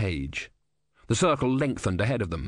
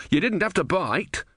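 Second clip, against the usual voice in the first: higher, hurt protest; indignant Brother; 9 semitones higher than usual. A narrator's reading. Brother complaining to Om after being bitten, indignant. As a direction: yelp it out in pain.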